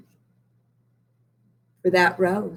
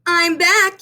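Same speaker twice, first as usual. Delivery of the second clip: high, louder call home; 9 semitones higher, 4 dB louder than usual. A narrator's reading. Benny calls to his family on coming home. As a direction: call out cheerfully from the door. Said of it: "he called".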